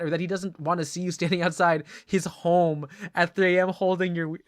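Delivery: ordinary talk, nothing marked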